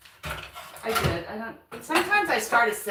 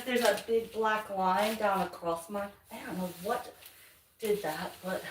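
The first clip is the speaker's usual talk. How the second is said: ordinary talk, nothing marked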